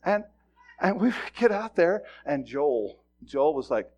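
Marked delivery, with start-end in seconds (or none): none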